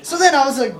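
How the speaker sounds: normal talking voice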